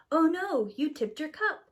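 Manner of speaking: a light startled gasp of oh no; mild surprise. Acted. A cup tips over on the tray, surprised oh no.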